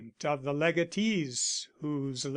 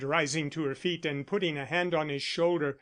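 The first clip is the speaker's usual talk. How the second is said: plain reading voice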